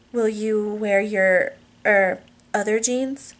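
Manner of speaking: slow, halting question with drawn-out words; hesitant request. Acted. Wife asks husband about his jeans, hesitantly. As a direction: stall on "er" before finishing the question.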